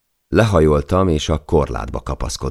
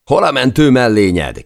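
much higher, loud call into the wind; shouted question; 11 semitones higher, 7 dB louder than usual. A narrator's reading. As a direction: shout across to Per over the storm. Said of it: "kiáltottam Perre". (magas, hangos kiáltás a szélbe)

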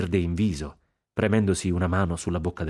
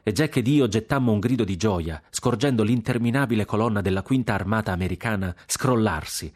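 usual speaking voice

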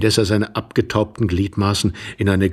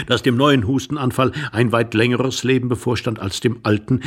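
usual speaking voice

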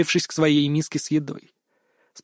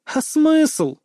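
condescending; 11 semitones higher than usual. A narrator's reading. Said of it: "снисходительно глянула она".

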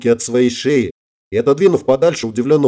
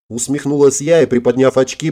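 ordinary delivery, nothing marked

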